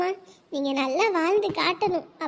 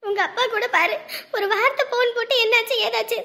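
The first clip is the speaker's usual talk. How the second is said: normal talking voice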